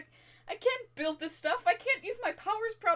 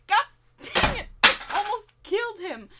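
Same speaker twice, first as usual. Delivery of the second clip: loud, higher frustrated snap; furious at herself, raised voice; angry outburst; 4 semitones higher, 9 dB louder than usual. Acted. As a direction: blurt it out angrily at yourself. Self-blame outburst.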